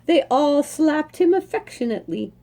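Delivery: lively read-aloud storytelling voice; story narration. A narrator's reading. Reading a horse story aloud to listeners, lively storytelling. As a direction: read it like a picture book to children, bright and lilting. Reads picture book aloud.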